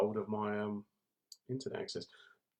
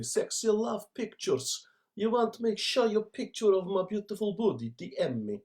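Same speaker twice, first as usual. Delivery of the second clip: mock Eastern European accent; 8 semitones higher than usual. Imitating Eastern European spam message.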